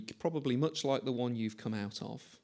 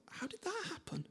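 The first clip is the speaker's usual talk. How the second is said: dismayed realisation; 13 dB quieter than usual. Retold or imitated.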